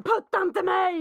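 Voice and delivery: high, loud, slow imitated protest; angry kid. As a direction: bark it like an offended child. Son shoved through door, protesting. (arg barnröst, högt och långsamt)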